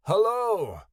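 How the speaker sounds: high, loud, arching call; loud, high hello. A narrator's reading. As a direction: call into a cave to test the echo. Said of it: "he called".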